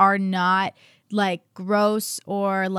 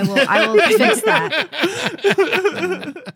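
laughing; 8 semitones higher, 4 dB louder, much slower than usual. Real, not acted. Laughing apology after tagging mistake.

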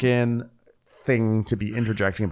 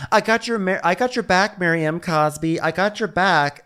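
imitating Jen; 7 semitones higher than usual. Jen Shah reassuring Mary, imitated.

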